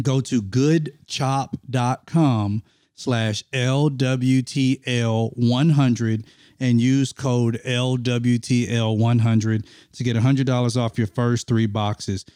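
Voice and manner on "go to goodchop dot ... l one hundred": slow and deliberate, every letter clear; slow, spelled-out promo code. Shown as a demonstration. Host to listeners, reading the promo code slowly.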